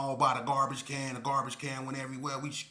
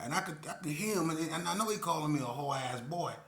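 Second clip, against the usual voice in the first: normal talking voice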